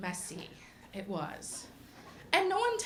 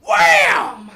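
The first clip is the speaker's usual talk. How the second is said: loud high shouted wham; toddler shouting wham; 9 semitones higher, 17 dB louder than usual. Retold or imitated. Little boy smashing a friend with a truck, loud shouted wham. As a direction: bash it out like a kid with a truck. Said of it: "and he goes".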